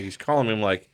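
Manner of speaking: normal talking voice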